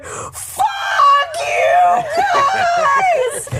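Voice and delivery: high, loud, drawn-out screaming "Fuck you guys!" through laughter; screamed curse. Retold or imitated. Friend reenacting the wife screaming at them on the ride, drawn-out laughing shout. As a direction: howl it at your friends. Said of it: "And she starts going".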